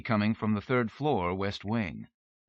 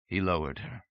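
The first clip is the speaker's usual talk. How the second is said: ordinary delivery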